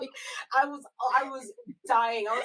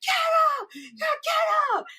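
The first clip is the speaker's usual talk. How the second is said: high, loud hammed-up shout, slowing at the end; imitating the heroine shouting at the killer; mock yelling; 5 dB louder, slower than usual. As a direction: yell at the killer to get up, slow on the last get up. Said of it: "Why you gotta yell at him".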